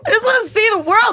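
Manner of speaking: high, loud, put-on voice, half-joking self-mockery; mocking herself as dreamy traveler. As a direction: play your own gushing tourist self up high. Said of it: "started making fun of".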